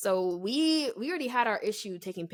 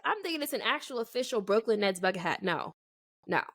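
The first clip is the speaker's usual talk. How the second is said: ordinary delivery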